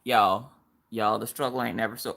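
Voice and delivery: ordinary talk, nothing marked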